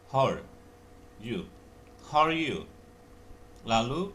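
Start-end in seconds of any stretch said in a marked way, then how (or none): 0.1-2.7 how are blended into one sound; linked how are. Shown as a demonstration. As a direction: join how and are, then you. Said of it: "how are digabung".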